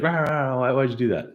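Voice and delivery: high, hammed-up angry rah rah rah; mock angry yell. Man knocked into river yells at kids in mock anger. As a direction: play the furious man in the river. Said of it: "He gets angry at the kids".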